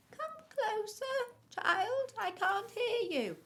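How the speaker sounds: very high, loud fake granny; wolf pretending Granny. A narrator's reading. Wolf lures the child closer, high fake granny voice. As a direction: beckon in a high put-on old-lady voice. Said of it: "pretending to be Granny".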